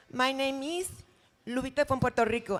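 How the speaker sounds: put-on Lupita from Puerto Rico in a light Puerto Rican accent, Rico as REE-ko. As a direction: introduce yourself as Lupita with a Spanish lilt. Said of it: "that's my other character".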